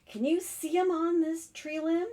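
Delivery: usual speaking voice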